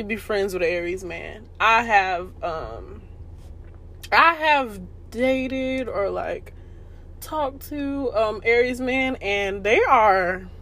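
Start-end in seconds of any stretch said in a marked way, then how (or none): none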